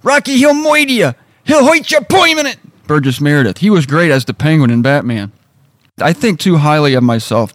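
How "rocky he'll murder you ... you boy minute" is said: put-on much higher, old trainer voice; Mick impression. Shown as a demonstration. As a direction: croak it like a crusty old boxing coach. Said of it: "I can do Mick a little bit".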